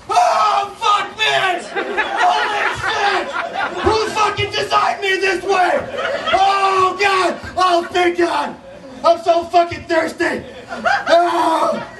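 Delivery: very high, frantic cursing butterfly; panicked butterfly. Pretend. Real butterfly panicking while flapping around, very high and frantic. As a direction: shriek it like a terrified bug.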